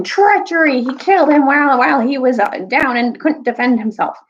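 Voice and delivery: high, loud accusing cry; accusing yell. Retold or imitated. Lords yelling accusations at Peter, loud and high. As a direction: shout the accusation for the whole army. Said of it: "were yelling".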